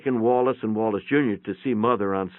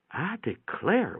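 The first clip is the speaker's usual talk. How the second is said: put-on elderly mother; 8 semitones higher, 5 dB quieter, slower than usual. Old mother voiced in story.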